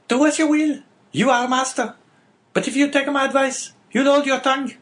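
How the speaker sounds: high, scolding wife; sharp wife's voice. Acted. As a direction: warn him off bitterly. Wife warns husband.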